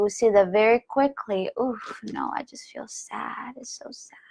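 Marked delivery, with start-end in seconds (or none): 1.6-4.2 breathy sad whisper fading almost to nothing. Pretend. To listeners, voicing the sadness under anger in a whisper. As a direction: let the anger melt into a tearful hush.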